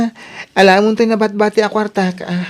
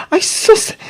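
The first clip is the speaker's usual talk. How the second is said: exasperated exclamation. Acted. Exasperated reaction to money request.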